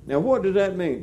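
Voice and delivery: ordinary talk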